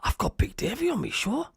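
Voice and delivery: high, hushed astonishment; acting out stunned delight; amazed disbelief. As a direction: squeak it softly as if you can't believe it. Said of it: "I was like".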